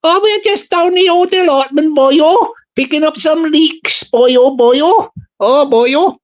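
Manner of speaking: mock Welsh. Streamer voicing a Welsh recruit, hammed-up Welsh lilt. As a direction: ham up a Welsh volunteer, boyo. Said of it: "we call them the Taffies".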